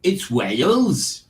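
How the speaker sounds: drawn-out, swooping Welsh lilt; Welsh accent. Acted. Quiz host giving the answer Wales, mock Welsh accent. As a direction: stretch it out in singsong Welsh.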